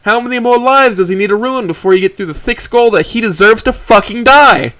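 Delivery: high, loud enraged yelling, slowing at the end; furious outburst. Acted. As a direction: unload all the rage on him. Trish raging at Mo.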